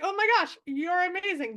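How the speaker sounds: high, loud delighted gush; mock gush over a great find. Gushing at the Italian researcher, delighted.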